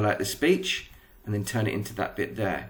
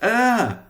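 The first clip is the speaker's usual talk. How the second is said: a sharp high mock scream; a quick high acted shriek; short scream; 10 semitones higher, 5 dB louder than usual. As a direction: yelp it out in a fright. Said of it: "And then Jenny screams".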